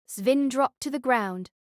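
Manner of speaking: ordinary delivery, nothing marked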